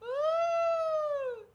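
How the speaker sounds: high whooping cheer; excited cheer. Real, not acted. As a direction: let out a big happy whoop. Cheering alone on stream.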